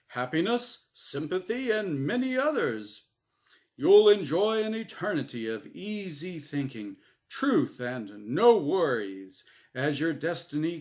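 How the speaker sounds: prize announcer. Acted. Announcer Gabe describes Norm's prize like a commercial. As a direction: sell the prize like a TV ad.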